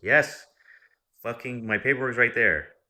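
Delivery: high, loud outburst of frustration; exasperated shout. Retold or imitated. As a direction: blow up at the clerk after hours of waiting. Exasperated after eight hours.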